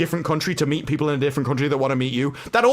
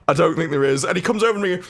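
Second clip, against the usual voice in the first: usual speaking voice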